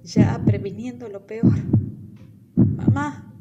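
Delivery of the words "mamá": higher, louder frightened plea; scared plea. Acted. Girl to her mother, scared and pleading. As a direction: call out to mom in fear. Girl thinks she's dying.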